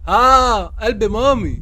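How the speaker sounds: put-on high cooing baby talk; playful high coo to a scary creature; mock cooing. Cooing to scare off monster.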